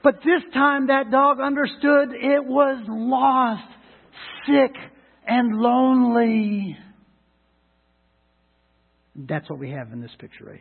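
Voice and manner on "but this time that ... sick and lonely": loud, higher, slow preaching voice. Acted. Preacher telling the congregation the dog's fate, loud slow preaching. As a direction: drive the point home slowly.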